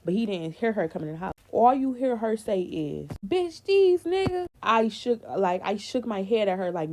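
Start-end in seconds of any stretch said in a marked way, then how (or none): 3.3-4.5 high, drawn-out retold outburst; imitating a friend's loud gossip opener; retold blurt.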